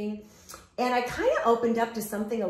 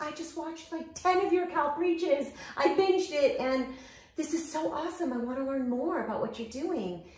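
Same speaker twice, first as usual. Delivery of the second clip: gushing texter; 8 semitones higher than usual. Retold or imitated. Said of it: "She was like".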